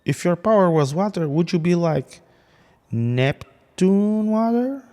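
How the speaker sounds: high-pitched goofy taunt, drawn out at the end; teasing Spider-Man. Retold or imitated. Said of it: "a Spider-Man going to the dude and going like".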